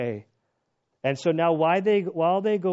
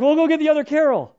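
humouring nurses; 11 semitones higher, 9 dB louder, faster than usual. Retold or imitated.

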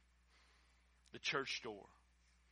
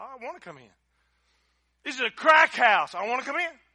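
eager Jesus; 8 semitones higher, 11 dB louder than usual. Acted. Jesus eager to enter house.

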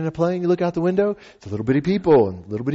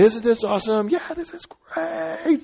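high, strained thrilled cry, held back in volume; imitated distant yelling; 12 semitones higher, 5 dB quieter, slower than usual. Guy behind and skydiver in freefall, mock high yelling. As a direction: mimic yelling over freefall wind. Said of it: "he's yelling".

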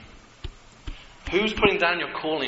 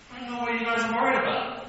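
unbothered confidence; 10 semitones higher, faster than usual. Acted. David's thoughts on seeing Goliath.

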